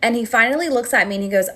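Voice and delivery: usual speaking voice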